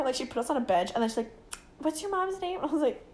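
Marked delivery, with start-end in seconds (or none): 1.8-2.6 high, soft questioning voice; store lady. Retold or imitated. Store lady to lost girls, high soft voice. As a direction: ask a lost child softly.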